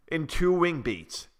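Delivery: ordinary delivery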